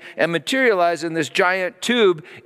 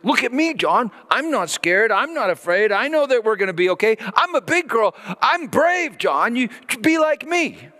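put-on high, bright-eyed little girl voice, much higher; little daughter bragging; 8 semitones higher than usual. Daughter lecturing big brother, high proud little-girl voice. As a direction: play the eight-year-old showing off her courage. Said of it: "She's like".

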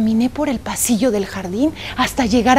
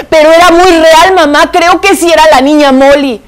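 put-on upset child; 5 semitones higher, 7 dB louder than usual.

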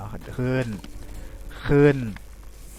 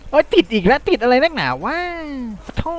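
real frustration, voice jumping up; exasperated outburst; 9 semitones higher than usual. Real, not acted. Gamer stuck again, yelling at the game, exasperated.

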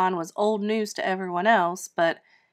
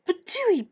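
spitting; 10 semitones higher than usual. Acted. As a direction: spit it out like a bad taste. Spitting after kiss.

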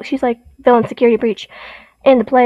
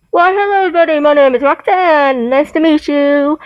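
Roxanne; 2 semitones higher, 3 dB louder than usual. Acted. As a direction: put on a big showy robot-character voice. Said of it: "really good impression of Roxanne".

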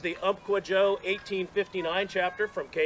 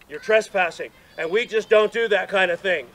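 louder, emphatic telling-off; mock scolding; 8 dB louder than usual. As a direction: imitate a brother laying down the rules. Retold scolding by a chapter brother.

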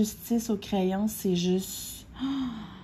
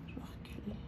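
whispered aside; 20 dB quieter, much faster than usual. Real, not acted. Aside while backing from the camera.

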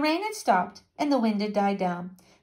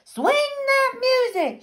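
rousing shout; 4 dB louder than usual. A narrator's reading. Pirate ghost to the musicians, high rousing shout. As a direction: call out to rouse the band. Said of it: "shouted Jean Lafayette's ghost".

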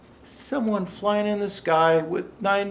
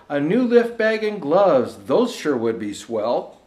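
measured, rhythmic storybook reading; lilting holiday verse reading; poem recitation; 2 semitones higher, 7 dB louder than usual. Acted. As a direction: read it like a bedtime Christmas rhyme. Rhymed Christmas poem read aloud.

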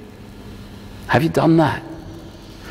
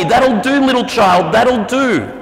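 God's approval; 6 semitones higher than usual. Retold or imitated. God voiced approving believer.

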